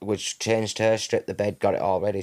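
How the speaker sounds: normal talking voice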